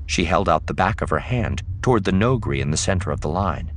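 plain reading voice

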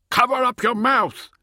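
high, loud shouted order; urgent shout. A narrator's reading. Shouting a warning to the freezing uplander. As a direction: shout it hard at the top of your voice. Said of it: "he shouted".

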